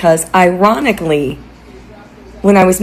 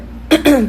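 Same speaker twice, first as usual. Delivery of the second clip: sharp choking throat clear; 9 semitones higher than usual. Real, not acted. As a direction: clear your throat mid-sentence. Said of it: "pardon me, I'm choking over here".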